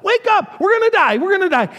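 high, loud alarm; frightened fishermen yelling to wake Jesus; panicked cry. Acted. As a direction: shout in terror over the storm. Disciples panicking in the storm.

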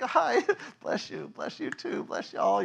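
high, slow, nervous hello with a laugh; nervous cheery pastor. Retold or imitated. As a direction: greet them sweetly, hiding fear. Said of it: "and I'm like".

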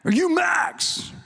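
high, drawn-out holler; yell across distance. Retold or imitated. Landscaper hollering across the parking lot at new guy, yelling. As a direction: call out long and high to someone far off. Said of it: "I yell across the parking lot".

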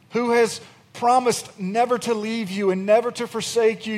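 ordinary talk

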